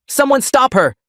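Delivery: father shouting at relatives to stop his daughter. Acted. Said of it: "Dad shouted".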